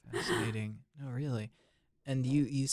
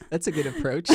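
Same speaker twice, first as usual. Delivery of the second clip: laughing; 10 dB louder, faster than usual. Real, not acted. Amused reply to student's plan.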